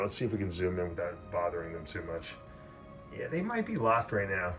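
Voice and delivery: hushed so the snakes stay undisturbed; soft, careful voice while filming; wildlife watching whisper. Real, not acted. Zooming in, not disturbing snakes.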